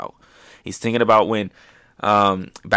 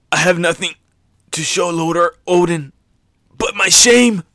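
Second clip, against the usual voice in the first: rising to a high, loud, despairing cry; anguished cry; 8 semitones higher, 6 dB louder than usual. Retold or imitated. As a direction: wail your shame out. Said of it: "He's crying".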